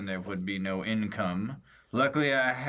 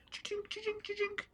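coin clink sound; 10 dB quieter than usual. Pretend. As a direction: mimic coins clinking in a hand. Imitating coins clinking in hand.

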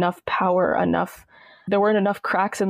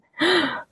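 mock gasp; 9 semitones higher than usual. Shocked at sister in towel.

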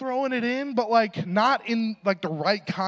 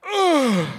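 louder, higher anguished groan; crushed groan; 7 semitones higher, 6 dB louder than usual. Retold or imitated. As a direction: let out a pained cry of guilt. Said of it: "my heart was like".

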